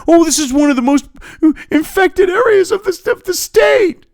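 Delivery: high, mock-alarmed official voice. Ranting vlogger mocking health officials, high mock-alarmed voice. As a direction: sneer it high like a fussy bureaucrat.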